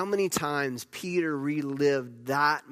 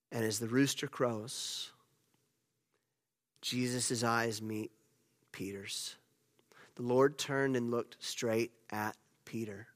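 much softer and lower, dropping to a near whisper on Peter's; hushed storytelling; 5 semitones lower, 7 dB quieter than usual. Acted. Hushed climax of the denial.

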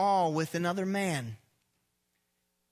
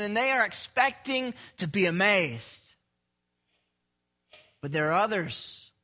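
ordinary delivery

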